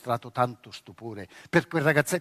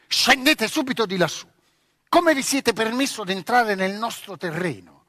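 put-on louder, sharp scolding girl; angry command; 6 semitones higher, 5 dB louder than usual. Girl furious at boy on her tree, scolding. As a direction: order him down in a fury.

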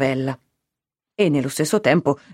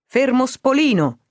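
higher-pitched calling out of a name; restrained narrated shout; 8 semitones higher than usual. A narrator's reading. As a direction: call out to rouse a sleeper, without full volume. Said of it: "una voce che gridava". (grido trattenuto per svegliare qualcuno)

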